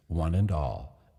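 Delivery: ordinary delivery, nothing marked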